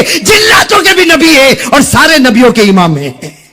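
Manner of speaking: very high, impassioned shouted sermon; fiery preaching. Acted. Preacher exalting the Prophet before the congregation, fiery preaching. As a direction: cry out each line at full force, easing only at the end.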